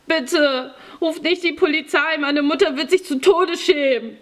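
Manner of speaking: put-on high, tearful boy begging; desperate pleading. A narrator's reading. Boy begs them not to call police, desperate pleading. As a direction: plead frantically like a scared child.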